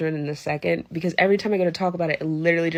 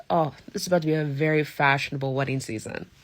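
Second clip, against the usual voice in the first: usual speaking voice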